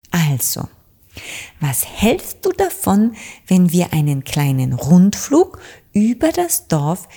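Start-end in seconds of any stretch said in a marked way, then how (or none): none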